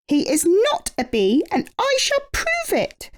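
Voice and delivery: high, determined little bee; Amelia voice. A narrator's reading. Amelia insisting to the bees that Norman is no bee, high and determined. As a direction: vow it with indignant resolve. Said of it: "said Amelia".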